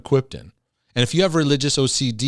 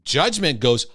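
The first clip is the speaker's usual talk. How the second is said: ordinary delivery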